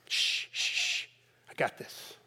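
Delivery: whispered shh, I got this; shushing whisper. Acted. Disciple hushing the worried father in a whisper. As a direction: hush the father confidently, under breath.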